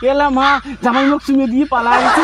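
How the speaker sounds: high, loud wailing complaint; loud lament. Acted. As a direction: cry out in theatrical complaint. Acted complaint after being kissed.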